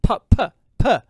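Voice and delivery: P, p, p spat hard and loud; popping plosive p's. Shown as a demonstration. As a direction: punch each P to test plosives. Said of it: "there might be some plosives".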